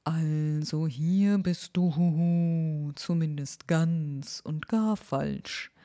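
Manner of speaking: slow, whispery owl giving directions; the owl drawing the long route; slow breathy owl voice with a hoot. Acted.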